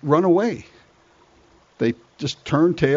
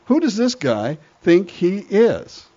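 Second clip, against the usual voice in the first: slow, scornful challenge; indignant David; 6 semitones higher, slower than usual. Acted. Young David scoffing at Goliath, slow and scornful. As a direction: sneer at the giant's nerve. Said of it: "David says".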